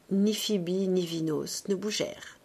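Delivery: ordinary delivery, nothing marked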